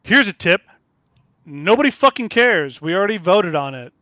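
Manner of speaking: loud, high exasperated outburst; exasperated rant. Real, not acted. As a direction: snap it at the complainers. Ranting at complainers.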